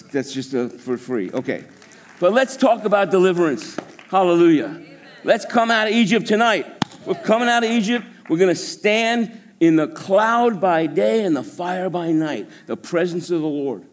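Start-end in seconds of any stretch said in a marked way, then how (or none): 2.2-13.8 louder, higher preaching burst; rally the congregation with fervour. Real, not acted. Preaching builds up.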